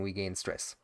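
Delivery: we gain stress muttered softly in defeat; resigned mutter. Acted. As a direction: let it drop away in defeat. Same phrase repeated, resigned.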